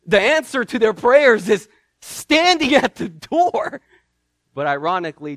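normal talking voice

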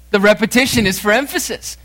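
"repetition" pitched high and punched; quick, high push on "repetition"; emphatic repetition. Shown as a demonstration.

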